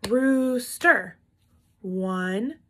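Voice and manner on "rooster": roo-ster split in two beats; syllable clapping. Shown as a demonstration. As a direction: count the claps on rooster. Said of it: "let's count how many times we clapped".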